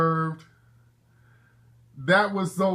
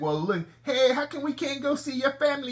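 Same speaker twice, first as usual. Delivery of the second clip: put-on high, soft pleading girlfriend voice; 8 semitones higher, 3 dB quieter than usual. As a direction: mimic a wheedling foreign girlfriend. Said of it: "they gonna be like".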